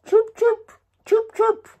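high quick chirps; bird chirping. Pretend. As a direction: sound like a little bird. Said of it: "And him hear".

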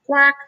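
high duck quack. A narrator's reading. Duck noise.

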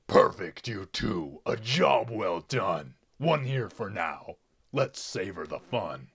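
loud, smug villain praise; gloating pig villain. Acted. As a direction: savour every word of the win.

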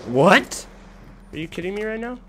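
loud high startled what; shocked outcry. Real, not acted. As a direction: blurt it out in shock. Surprising death in game.

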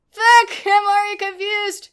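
high, loud exasperated swearing; loud real exasperation; frustrated outburst. Real, not acted. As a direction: burst out in confused frustration. Swearing at confusing game controls.

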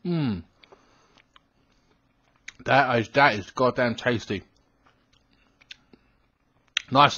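chewing between words; talking while chewing. Real, not acted. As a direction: mouth partly full of cookie. Seen 1: Lips and cheeks move as if chewing; cookie bowl held throughout.

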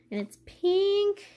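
high delighted exclaim; light up over the color; excited. Real, not acted. Excited about pink cup.

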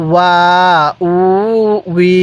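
"ওয়া, উ, উই" each held long and level; measured syllable chant of "ওয়া, উ, উই"; drawn-out vowels. Shown as a demonstration. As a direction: stretch "ওয়া, উ, উই" with pauses between. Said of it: "এরকমভাবে শিখব".

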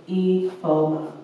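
name drawn out slowly, each syllable spaced: Ifoma. Retold or imitated. Repeating her name slowly for the customer.

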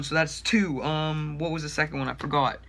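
ordinary talk

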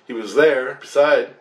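ordinary delivery

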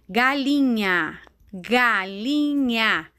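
drawn-out syllables, very slow; slow dictation. Shown as a demonstration. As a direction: sound the word out slowly for young learners. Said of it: "igual a Pro está falando".

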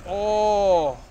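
loud, high startled oh; startled shout. Real, not acted. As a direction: react to a sudden shock. Sudden threat in game.

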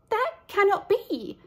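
high, pleading little lamb; a timid high lamb. Acted. Lamb protests.

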